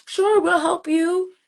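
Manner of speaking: high, slow mock of easy generosity; lightly voiced eager helper; generous voice. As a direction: play the eager helper, drawn out. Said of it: "we like".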